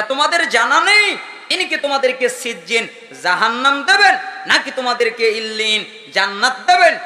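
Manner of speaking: preacher's delivery with loud, high phrase endings; forceful preaching. Real, not acted. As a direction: drive each clause up to a punched final word. Preaches with rising phrase ends.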